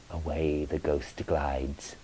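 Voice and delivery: ordinary delivery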